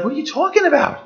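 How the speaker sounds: high, baffled disbelief; incredulous. Pretend. Hurt spouse reacting to a sarcastic reply, high and incredulous. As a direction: act stunned that anyone would doubt it. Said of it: "And you're like".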